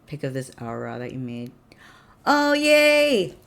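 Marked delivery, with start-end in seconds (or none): 2.2-3.3 high, loud squeal of delight; excited cheer. Real, not acted. Streamer greeting a picture of an Aura, excited cheer. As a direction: cheer with real delight, drawn out.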